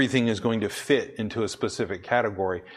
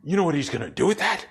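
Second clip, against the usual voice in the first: lightly voiced alarmed parents, faster; worried parents; 5 semitones higher than usual. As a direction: play the panicking parents, a bit quick. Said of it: "the parents are like".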